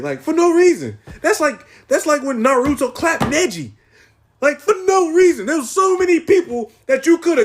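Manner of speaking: hammed-up fan fury; mock outrage. Outraged rant about Neji.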